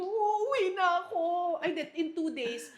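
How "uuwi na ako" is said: whiny cry. Retold or imitated. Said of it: "Sabi ko".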